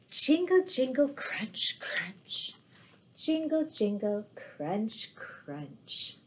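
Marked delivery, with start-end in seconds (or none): none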